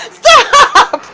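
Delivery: high-pitched laughing cry of stop; laughing as she tells the dogs to stop. Real, not acted. Owner laughing at the dogs, high laughing stop.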